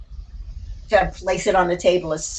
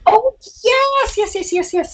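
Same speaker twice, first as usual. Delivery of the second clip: excited; 13 semitones higher, slower than usual. Real, not acted. Eager to show her doll.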